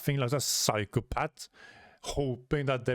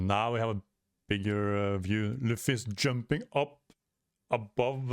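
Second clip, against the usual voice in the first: plain reading voice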